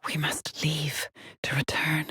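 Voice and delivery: soft, whispery musing; hushed whisper. Acted. Astronaut muses on leaving to return, hushed whisper. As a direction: whisper it softly, as a private thought.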